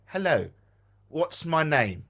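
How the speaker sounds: ordinary delivery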